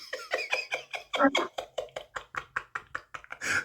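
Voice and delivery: high airy laughter; big laugh. Real, not acted. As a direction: burst out laughing. Laughing after the punchline.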